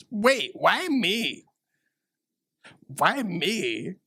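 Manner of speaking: slow, high whining despair; mock whine. Granddaughter protesting her fate in a slow, high whine. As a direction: wail it like a movie heroine.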